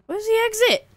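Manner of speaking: high, loud cry; frustrated yell. Real, not acted. Player lost looking for the exit, high loud yell.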